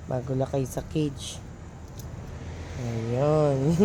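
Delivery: low, soft murmur; hushed talk. Real, not acted. Owner speaking softly near the dogs, hushed. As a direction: keep it down so the dogs stay calm.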